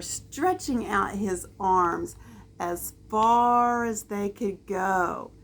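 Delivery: ordinary delivery, nothing marked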